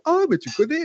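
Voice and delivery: high, bright surprised question. Retold or imitated. Interviewer amazed to spot his Warhammer figurines. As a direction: act amazed that he knows Warhammer. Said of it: "elle fait".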